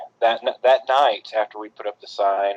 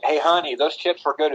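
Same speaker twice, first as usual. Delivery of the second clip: usual speaking voice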